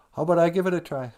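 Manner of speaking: high, fast little muskrat voice; small creature piping up eagerly. Acted. Muskrat offers to dive, high quick little voice. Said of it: "they hear a little voice".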